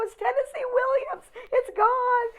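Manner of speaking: very high, loud awestruck shriek; retold scream. Starstruck girl announcing the caller, reenacted scream. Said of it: "And I screamed".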